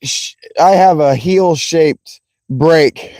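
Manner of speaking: ordinary delivery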